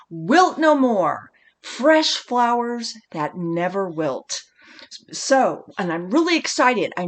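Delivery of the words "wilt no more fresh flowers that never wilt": slow, savoring each word; slow slogan pitch for a bouquet. Shown as a demonstration. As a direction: sell it like a tagline, drawn out. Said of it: "slow slogan pitch".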